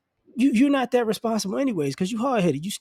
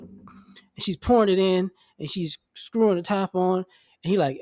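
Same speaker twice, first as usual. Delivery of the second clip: normal talking voice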